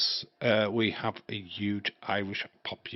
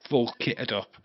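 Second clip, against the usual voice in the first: normal talking voice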